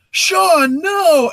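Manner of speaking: high, loud desperate yell; panicked shout. Retold or imitated. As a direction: shout to stop him mid-swing. Seeing brother swing the hammer.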